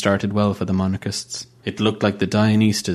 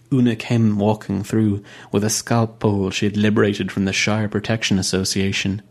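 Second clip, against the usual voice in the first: usual speaking voice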